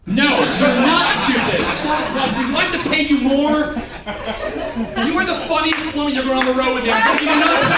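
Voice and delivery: tickled, exclaiming over the laughs; amused exclaiming. Real, not acted. Trainer cracking up at trainee's remark.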